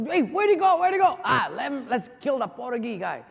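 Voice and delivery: high, loud, bewildered calls; confused guard shouting. Acted. Guards searching for the escaped prisoner, loud confused shout. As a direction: shout around looking for him.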